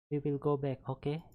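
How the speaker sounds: a hint of a non-Russian foreign accent, gently steering; vague foreign accent. Ned gently leading Sean away, lightly foreign accent. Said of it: "sound like she has some sort of, like, an accent".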